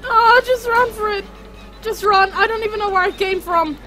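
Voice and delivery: high, loud, breathless; panicked running. Real, not acted. Gamer fleeing the monster, loud panicked chatter. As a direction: talk fast while fleeing.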